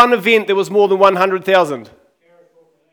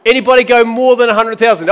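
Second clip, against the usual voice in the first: auctioneer; 3 semitones higher, 4 dB louder, faster than usual. Pretend. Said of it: "I feel like an auctioneer".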